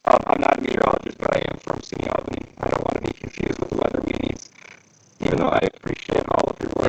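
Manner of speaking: robotic voice filter; glitchy robot voice. Real, not acted. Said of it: "you sound awful".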